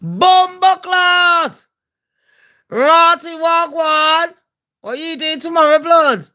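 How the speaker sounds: high, drawn-out Jamaican greeting; Jamaican accent. Acted. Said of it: "I'll be chatting like the Jamaicans".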